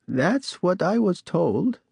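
put-on high, slow, frail old lady; a lightly voiced elderly woman, slow. A narrator's reading. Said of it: "she said".

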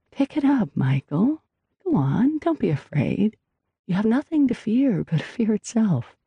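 high-pitched, coaxing mermaid voice; lilting, high character voice of encouragement. A narrator's reading. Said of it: "It was the mermaid herself who seemed to be speaking".

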